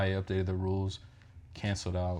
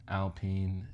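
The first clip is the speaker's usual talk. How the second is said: usual speaking voice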